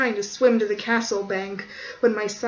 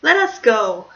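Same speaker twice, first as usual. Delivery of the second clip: hero's call; 3 semitones higher, 9 dB louder, slower than usual. Acted. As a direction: declaim it like a movie hero. Hero declaiming.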